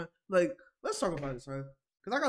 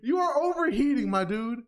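mock scolding; 5 semitones higher than usual. Real, not acted. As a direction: scold him like an annoyed friend. Mock lecture to Twerk.

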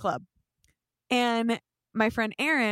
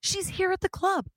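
high-pitched indignant insistence; heated; 10 semitones higher than usual. Retold or imitated. Girl on the phone outside the club, heated. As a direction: mimic a worked-up caller driving it home. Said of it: "in a heated conversation".

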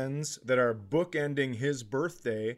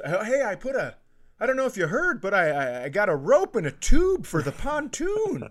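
much higher, louder, overeager sell; overexcited mother luring her grown son; eager coaxing; 10 semitones higher, 7 dB louder than usual. Retold or imitated. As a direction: pitch the pontoon tube like a desperate mom. Mom luring son with tube.

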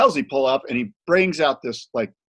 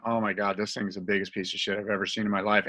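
hushed disgust; 5 semitones lower, 8 dB quieter than usual. Retold or imitated. Evan disgusted at board.

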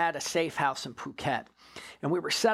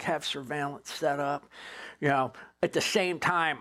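usual speaking voice

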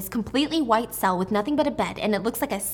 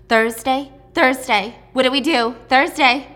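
panicked calling; 3 semitones higher, 6 dB louder than usual. Retold or imitated. Panicked sister calls out.

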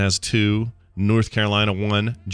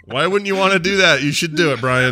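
higher and louder, laughing through the plea; laughing urging; 8 semitones higher, 3 dB louder than usual. Real, not acted. Urging Brian to wear the luchador mask, laughing. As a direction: egg him on while cracking up. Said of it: "laughing urging co-host".

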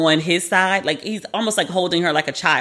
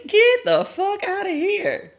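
mock dismissal; 13 semitones higher, slower than usual. Dismissing bossy partner idea.

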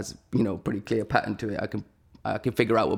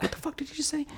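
very high, stifled squeal of disbelief; mock inner scream; 6 dB quieter, faster than usual. Storyteller screaming inside while acting chill, tiny high-pitched mock scream. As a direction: scream it small, as if only inside.

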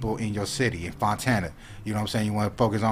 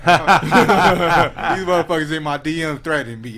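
amused, laughing; 5 semitones higher, 7 dB louder than usual. Real, not acted. Host recounting angry DMs to his interviewer, laughing. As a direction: laugh through the complaint, louder and higher.

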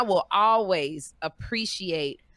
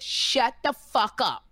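retold cursing; 2 semitones higher, slower than usual. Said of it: "I cursed him out so bad".

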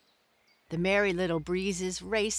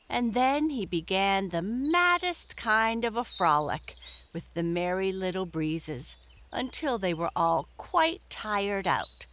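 ordinary delivery, nothing marked

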